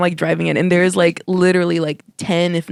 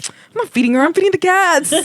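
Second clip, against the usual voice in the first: defensive dad voice; 13 semitones higher than usual. Retold or imitated. Dad quoted defending himself.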